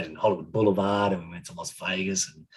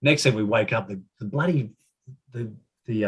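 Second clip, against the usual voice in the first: ordinary talk, nothing marked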